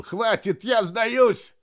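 high desperate yell, pleading. A narrator's reading. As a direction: scream it out, begging for mercy. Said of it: "Тадино завопил".

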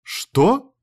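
high, startled question; shocked exclamation. A narrator's reading. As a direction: exclaim in disbelief. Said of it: "воскликнула та".

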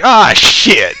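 dismayed "ah, shit". Retold or imitated. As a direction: groan it out, dismayed. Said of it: "I was like".

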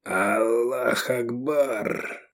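very slow, strained last words; dying croak. A narrator's reading. Dying believer on his knees, croaking. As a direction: croak it out, long drawn and failing. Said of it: "пал на колени и прохрипел".

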